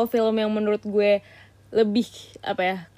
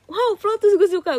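high, loud fan gushing; mock excitement; 10 semitones higher, 5 dB louder than usual. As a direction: imitate an excited plot-twist fan. Mimics people who love twists.

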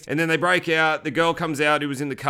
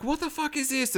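shocked cry; 11 semitones higher, 5 dB quieter than usual. Retold or imitated. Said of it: "she's like".

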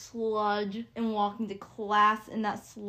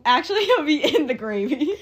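laughing joke; 6 semitones higher, 13 dB louder than usual. Pretend.

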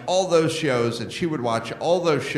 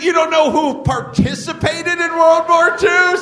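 much higher, louder, with a laugh; incredulous shout; 13 semitones higher, 6 dB louder than usual. Retold or imitated. Mocking a radio guest who dodged the question, laughing shout. Retold disbelieving challenge.

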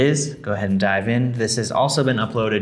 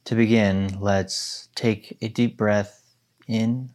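guided meditation. Acted. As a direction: guide them gently into stillness.

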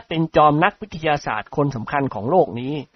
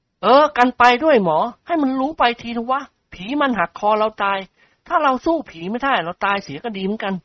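higher, bold declaration; said out loud; 8 semitones higher than usual. A narrator's reading. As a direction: speak up boldly to the doctor. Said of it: "พลพูดเสริมขึ้นดังๆ". (พลพูดเสริมเสียงดังอย่างกล้าหาญ)

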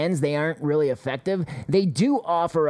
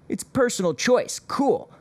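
sarcastic; 8 semitones higher than usual. Real, not acted. Sarcastic reply to government.

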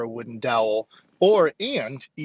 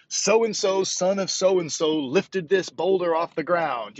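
higher, drawn-out reading of an ancient inscription; proclaimed inscription; 5 semitones higher, slower than usual. Acted. Lifter quoting a boulder's carved boast, proclaimed slowly and higher. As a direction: proclaim it like words carved in stone. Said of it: "carved into 'em saying".

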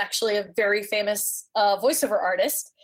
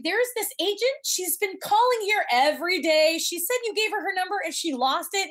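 gushing front desk voice, much higher; excited receptionist; 10 semitones higher than usual. Retold or imitated. Receptionist telling Shelley the agent called, high and gushing. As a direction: bubble over like a chatty receptionist.

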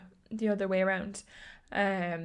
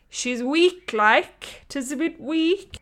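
higher, louder, slow put-on weak voice; voicing her own weak body, higher and louder; mock frail voice; 6 semitones higher, 6 dB louder, much slower than usual. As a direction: lightly play a feeble invalid.

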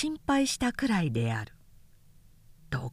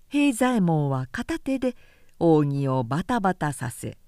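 plain reading voice

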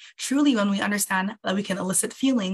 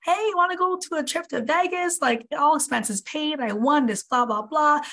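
higher-pitched gushing invitation, a mock excited girlfriend; excited girlfriend invite; 7 semitones higher than usual. Girlfriend pitching a Vegas trip, mock excited and high. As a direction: play the thrilled girlfriend offering a free trip. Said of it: "she's like".

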